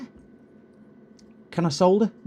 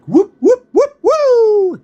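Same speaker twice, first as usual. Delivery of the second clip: loud whooping cheer, much higher; 14 dB louder, much slower than usual. Real, not acted. Whooping loudly as Manford comes back on stream. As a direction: whoop for a returning friend.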